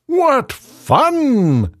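high hippo voice. Acted. Hippo speaks in the story.

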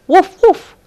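high, loud playful woof; dog barking. Acted. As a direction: bark like an excited little dog. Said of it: "went the dog".

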